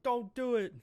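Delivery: a loud, high cry of alarm; pleading shout. Acted. Everyone begs Bonnie not to go through with it, pleading shout. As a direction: cry out to stop her in time. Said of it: "everyone's just like".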